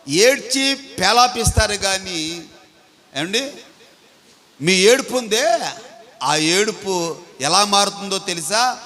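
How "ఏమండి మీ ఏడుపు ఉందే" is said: ordinary delivery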